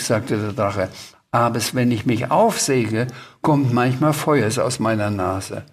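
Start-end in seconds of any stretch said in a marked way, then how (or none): 1.3-5.6 "aufsäge" for aufrege and "Feuers" for Feuer; R replaced by S. A narrator's reading. Dragon admits to Sams, "aufsäge" with S for R. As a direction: say "aufsäge" with S where R belongs. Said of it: "du kannst ja kein R sprechen".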